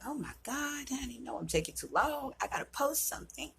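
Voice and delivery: high, soft and fast panic; fretting about taking too long; anxious rush. Retold or imitated. To herself, worried she's late: high, soft, rushed fretting. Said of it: "I'm like".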